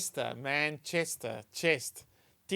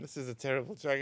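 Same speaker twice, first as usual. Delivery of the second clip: ordinary talk, nothing marked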